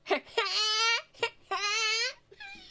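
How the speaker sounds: high, honking belly laugh; mimicked aunt's laugh. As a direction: imitate an obnoxious, squeaky laugh. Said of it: "I'm gonna mimic her laugh right now".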